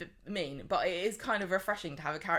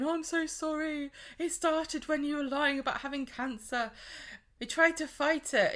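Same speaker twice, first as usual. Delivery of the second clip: much higher mock female voice; a high, hammed-up apologetic woman; mock Abby; 9 semitones higher than usual. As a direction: squeak it out as a weepy woman. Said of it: "Abby's saying".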